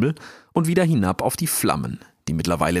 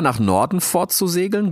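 plain reading voice

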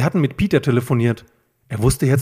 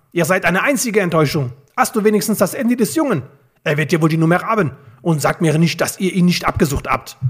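high, exasperated bickering; irritated villains squabbling; heated quarrel; 7 semitones higher, 3 dB louder than usual. A narrator's reading. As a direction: argue back in a raised pitch. Villains quarrel, losing their tempers.

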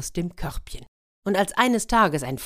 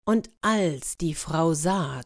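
ordinary delivery

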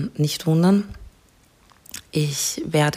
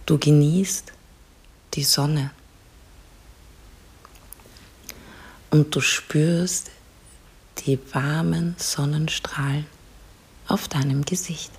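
soft spa whisper; 5 dB quieter than usual. Acted. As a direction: whisper calmly, guide them to relax. Leads a guided relaxation.

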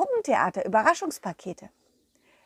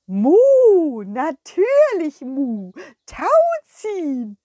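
high, loud, slow mooing cow; eager cow; 6 semitones higher, 8 dB louder, much slower than usual. Acted. The cow Kurita happily agreeing to tug of war. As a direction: moo your yes with delight.

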